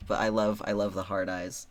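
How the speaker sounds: normal talking voice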